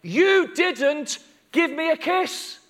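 slow, high, emphatic reproach; ringing, deliberate accusation; Jesus rebuking Simon. Acted. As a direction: call him out point by point. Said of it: "And he says".

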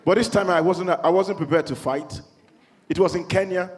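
usual speaking voice

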